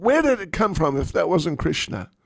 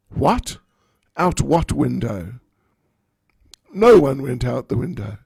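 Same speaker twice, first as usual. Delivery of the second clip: louder, brazen denial of the whole thing; bold denial; 7 dB louder than usual. Acted. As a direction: play innocent, loudly. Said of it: "And Lalita said".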